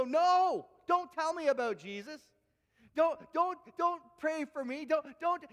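high, loud pleading no; imagined rejection. Retold or imitated. Pastor imagines someone refusing to hear about Jesus, loud and high. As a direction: push the speaker away, loud and high. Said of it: "someone go".

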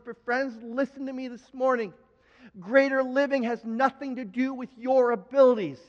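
normal talking voice

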